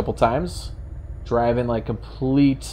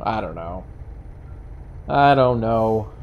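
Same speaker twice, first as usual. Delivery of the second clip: usual speaking voice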